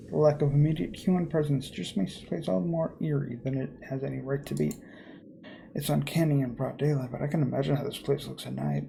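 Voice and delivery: normal talking voice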